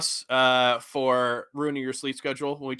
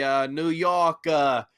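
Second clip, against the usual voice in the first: ordinary delivery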